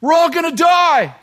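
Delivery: high, loud panicked scream. Acted. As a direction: scream it out like a panicking drunk passenger. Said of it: "the man in front starts screaming".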